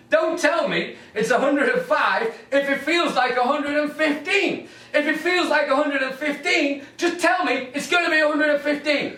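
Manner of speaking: high, incredulous comic rant with a laugh; exasperated rant. Acted. Comic ranting at weather forecasters, high and exasperated. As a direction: go way up in pitch, fed up with it all.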